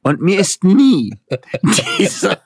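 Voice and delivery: a woman baffled she never noticed the course; mock bewildered woman. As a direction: ham up her disbelief, stretching it out with a laugh. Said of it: "lese ich".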